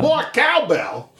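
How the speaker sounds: high, slow celebrity impression; exaggerated drawn-out put-on voice; Christopher Walken as Georgian. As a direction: stretch it out in a hammed-up impression. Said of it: "Christopher Walken was Georgian".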